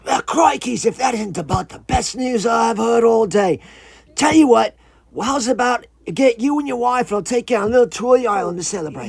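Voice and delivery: high, loud put-on iguana voice; relieved iguana. Relieved iguana offering the visitor an island tour, high loud voice. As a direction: crow with relief as a little creature. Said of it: "he was completely relieved".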